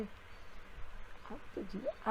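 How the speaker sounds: breathy slow whisper of the moral. Shown as a demonstration. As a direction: whisper it slowly, like a secret. Preacher repeats the moral line. (ધીમેથી ફૂસફૂસ કરીને)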